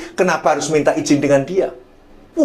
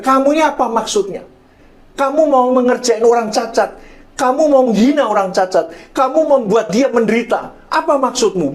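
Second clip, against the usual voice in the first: reenacted angry confrontation, pitched high; teguran marah; 7 semitones higher than usual. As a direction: scold him in a high, indignant rush of accusations. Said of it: "Saya marah sekali, saya katakan". (menegur dengan marah, bernada tinggi)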